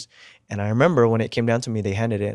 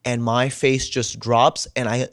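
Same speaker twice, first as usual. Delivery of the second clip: ordinary talk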